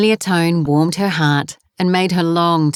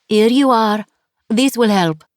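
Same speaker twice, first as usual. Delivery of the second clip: put-on French accent; 5 semitones higher than usual. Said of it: "her accent was heavy".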